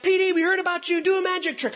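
put-on high, excited kid voice; eager kids. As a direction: play a pleading sixth grader, pitched well up. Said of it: "sixth graders are like".

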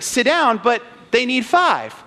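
high, puzzled protest; confused kid. Retold or imitated. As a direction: imitate a baffled player questioning an order. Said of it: "the poor kid is still very confused".

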